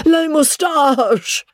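high, slow gasping plea about "Les moustaches"; gasped. A narrator's reading. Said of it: "gasped Joe".

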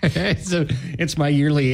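normal talking voice

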